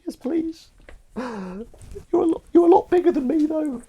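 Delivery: very high, squeaky mock-tearful voice; tearful squeak with a gasp; crying newcomer. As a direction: pipe up pitifully in a hammed-up voice. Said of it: "I found him crying in the corner".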